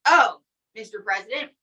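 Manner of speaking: ordinary talk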